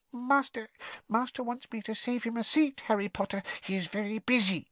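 dutiful, high-pitched elf voice; squeaky house elf. A narrator's reading. Winky explains the saved seat, dutiful squeak. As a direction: explain earnestly in a tiny squeak. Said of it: "a teeny quivering squeak of a voice".

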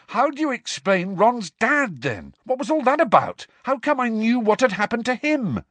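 high, heated barrage of questions; angry shouting. A narrator's reading. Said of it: "Harry shouted in her face".

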